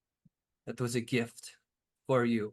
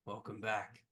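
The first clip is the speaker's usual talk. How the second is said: groggy; 3 semitones lower, 8 dB quieter than usual. Acted. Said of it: "In a groggy sort of similar voice".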